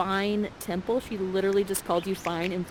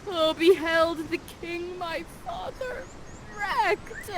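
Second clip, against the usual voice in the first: grieving prince; 8 semitones higher, slower than usual. Acted. Ferdinand mourns father.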